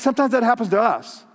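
ordinary talk, nothing marked

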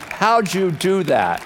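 high, drawn-out astonished question; slow right down, stressing each word in disbelief; emphatic amazement. Retold or imitated. Husband presses wife for her secret, slow emphatic amazement.

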